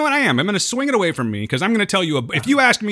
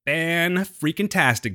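drawn out; much slower than usual. Real, not acted. Playful emphatic drawl.

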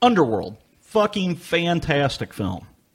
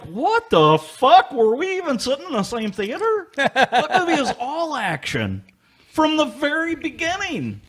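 incredulous; 11 semitones higher than usual. Retold or imitated. Acted disbelief at girlfriend's verdict.

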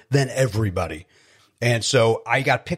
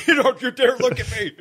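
higher, laughing mock of a crying dad; mock gruff dad; 12 semitones higher than usual. Son playing his crying father, laughing gruff mock. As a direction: laugh through a gruff don't-look.

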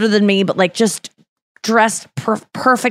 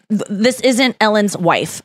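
ordinary talk, nothing marked

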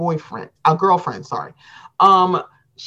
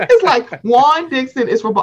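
laughing; 6 semitones higher, 4 dB louder than usual. Real, not acted. Laughing while retelling.

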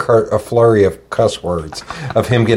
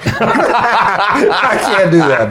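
laughing; 10 semitones higher, much slower than usual. Real, not acted.

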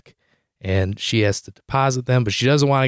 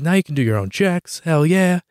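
higher, celebratory cheer; pleased, upbeat self-quote; retold excitement; 6 semitones higher than usual. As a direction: cheer her on, replaying your excitement. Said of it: "So I'm all excited".

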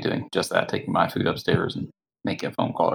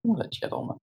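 sarcastic whisper; 13 semitones higher, 8 dB quieter than usual. Real, not acted. Mocking aside about the deputy.

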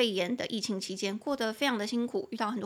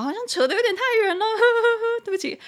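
much higher and louder, laughing it off; laughing apology; 11 semitones higher, 9 dB louder, much faster than usual. Real, not acted. Teacher apologizing for going off topic, laughing. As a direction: laugh at herself for rambling.

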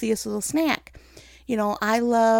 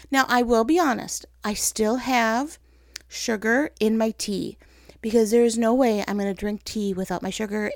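usual speaking voice

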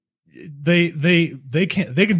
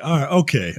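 usual speaking voice

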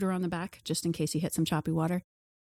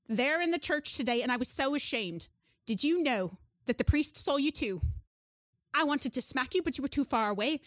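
higher-voiced grandma, scolding and shocked; scolding grandmother; 5 semitones higher than usual. Grandmother scolding Charlie about church, higher and shocked. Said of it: "And she says".

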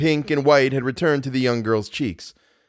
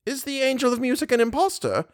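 put-on high-pitched old lady question; bewildered old mother; 13 semitones higher than usual. Simple old woman questions the visitor, high and bewildered. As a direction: ask it high, in naive dismay. Voices Mamma Valerius.

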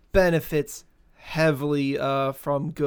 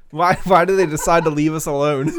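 laughing; 3 semitones higher, 6 dB louder than usual. Real, not acted. Laughing about listeners leaving.

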